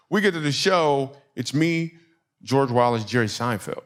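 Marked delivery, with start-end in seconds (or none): none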